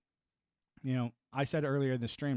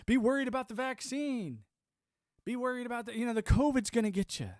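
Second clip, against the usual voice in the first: mock alarm; 8 semitones higher than usual. Mocking the media's covid scare.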